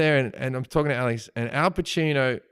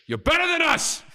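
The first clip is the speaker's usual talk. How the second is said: high, exclaimed impression; hammed-up movie-star outburst; Al Pacino; 14 semitones higher than usual. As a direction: burst out high with praise. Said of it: "He goes".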